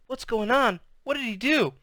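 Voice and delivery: put-on high, worried child's question; jittery kid wanting to know; anxious little sister. A narrator's reading. Frightened sister in story.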